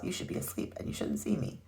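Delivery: soft, half-whispered warning; breathy hushed scolding. A narrator's reading. Santa hushing Amelia at night.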